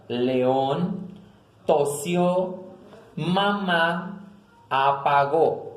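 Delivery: "León, tosió, mamá, apagó", one at a time, each stressed on the last vowel; final-vowel stress. Shown as a demonstration. Teacher lists palabras agudas, stressed final vowels. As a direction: set each word apart, leaning on the endings of "León", "tosió", "mamá", "apagó". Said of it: "la tilde en las últimas vocales".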